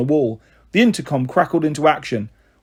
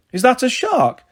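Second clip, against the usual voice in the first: high eager question, much higher; boy thrilled by the lighter; excited child; 9 semitones higher than usual. A narrator's reading. Little boy asking about the fish lighter, eager. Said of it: "Kendall asked".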